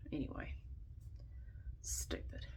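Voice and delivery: a single soft whispered word. Real, not acted. Dismissing the holiday policy to viewers, soft whisper. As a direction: breathe it out, barely voiced.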